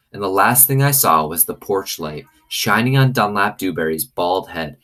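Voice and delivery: usual speaking voice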